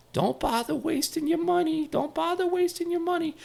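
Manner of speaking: very high, squeaky imitation of an old-timer. Old-timer turns down a Beatles Christmas gift, high-pitched impression. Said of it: "He goes".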